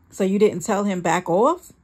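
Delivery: plain reading voice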